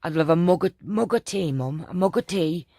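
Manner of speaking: put-on loud Yorkshire kid asking for tea; Yorkshire accent. Mum imitating daughter asking for tea, mild Yorkshire accent. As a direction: mimic a Yorkshire child, mild. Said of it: "and she'd say".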